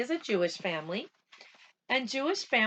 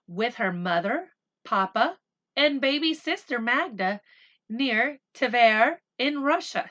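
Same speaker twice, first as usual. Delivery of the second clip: slow, even storybook reading; unhurried read-aloud voice; story narration; 2 semitones higher, 6 dB louder than usual. A narrator's reading. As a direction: read it gently to the children.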